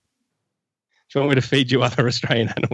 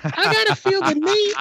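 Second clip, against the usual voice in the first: very high falsetto. Acted. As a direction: squeak it out like a cartoon girl. Falsetto character bit.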